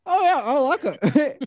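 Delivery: high, slow, strained approval; faking enjoyment. Retold or imitated. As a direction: force out polite liking. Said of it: "trying my best to act like I like it".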